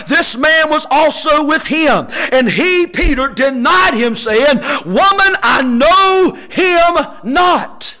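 loud, ringing scripture reading with drawn-out key words; impassioned pulpit voice; full-force preaching. Real, not acted. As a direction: preach the verses hard to the congregation.

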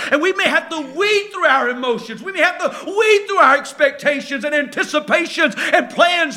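full-force sermon climb; fervent preaching. Acted. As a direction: preach it with rising fire.

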